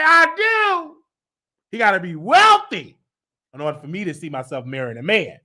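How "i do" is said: a high, coy pretend "I do"; mock bride vow. Pretending to say vows at the altar, high and coy.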